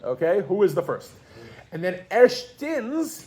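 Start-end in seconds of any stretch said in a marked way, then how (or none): 1.7-3.2 erstens drawn out slowly as ERSH-tens, higher; slow model word. Shown as a demonstration. Teacher modeling for class, erstens said slowly as ERSH-tens. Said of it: "does everybody see how it goes?".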